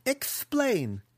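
high, drawn-out order; toying captor's prompt; curious demand. Acted. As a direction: invite it with lofty interest. Captor demands the captive's plan.

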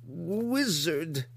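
high, slow monster growl of words; grotesque monster voice. Acted. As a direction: drag out evil wizard. Hookar names the wizard.

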